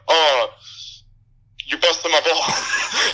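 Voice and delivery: slow, high hammed-up Italian-American drawl; mock Italian-American. Mocking Viggo's character ribbing his family in Italian-American voice. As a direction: stretch it out like a movie mobster. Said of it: "Viggo Mortensen ... his family going".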